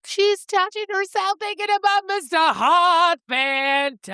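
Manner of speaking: high singsong crowing, last word stretched; teasing outburst. Pretend. Teasing outburst about the heroine.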